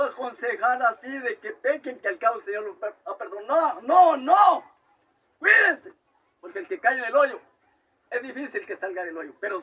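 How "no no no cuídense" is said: loud, high cry of no; urgent warning. Real, not acted. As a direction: stop them short with alarm. Preacher warning the congregation. (advertencia urgente, fuerte y aguda)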